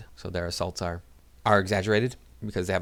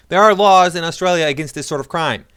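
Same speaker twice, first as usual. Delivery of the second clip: loud, higher, stressing ARE; emphatic loud declaration; 8 semitones higher, 10 dB louder than usual. Real, not acted. Vlogger to viewers, firmly stating the law, loud emphatic declaration. Restated emphatic claim, louder.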